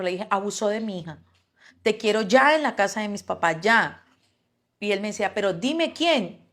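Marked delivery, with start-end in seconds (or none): 0.3-4.0 loud, urgent demand; retold outrage. Mother urgently summoning her husband by phone, retold outrage. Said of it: "lo traté de lo peor". 5.3-6.5 high, slow, louder retold question; urgent demand. Husband demanding to know who did it, urgent demand.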